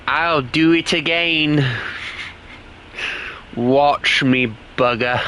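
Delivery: answering the imagined Brit in a hammed-up British accent; mock British accent. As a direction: taunt him back in a put-on British accent. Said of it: "And I'll be like".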